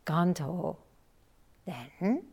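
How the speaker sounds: ordinary delivery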